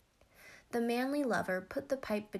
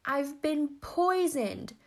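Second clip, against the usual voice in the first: high, loud, slow cry of agony; spluttered alarm; 6 semitones higher, 6 dB louder, much slower than usual. A narrator's reading. Manly lover announcing he's been poisoned, agonised splutter. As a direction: cry it out as a poisoned man, drawn out. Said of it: "spluttered the manly lover".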